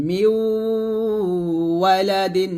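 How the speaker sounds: long hummed মিউ running into ওয়ালাদিন; merged ghunnah. Shown as a demonstration. Teacher repeating the merged example, long nasal মিউ. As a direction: stretch মিউ through the nose, no n sound. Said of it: "মিমকে ওয়াওকে ধরবে, গুণনা হবে".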